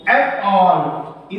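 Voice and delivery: एप ऑल drawn out very slowly; slow syllable split. Shown as a demonstration. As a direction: stretch एप ऑल apart syllable by syllable. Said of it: "अपॉल शब्द का उच्चारण जब आप करते हैं".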